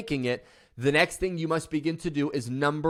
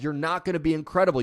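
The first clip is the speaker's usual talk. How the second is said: ordinary talk, nothing marked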